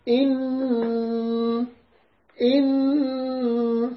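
إن held long with strong nasal hum; nasal ghunna on إن. Shown as a demonstration. Teacher models إن for the student, long nasal ghunna. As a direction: stretch the nun in إن with heavy ghunna. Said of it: "يلا".

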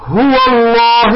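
"الله" with a dark, thick double l; slow "هو الله", rounding "الله" toward "lloh"; heavy lam. Shown as a demonstration. Teacher gives example after fatha, heavy lam in "الله". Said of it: "اللام نفخمها".

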